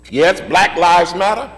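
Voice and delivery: normal talking voice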